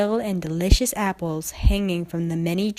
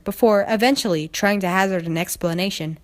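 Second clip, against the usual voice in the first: plain reading voice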